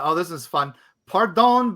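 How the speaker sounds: normal talking voice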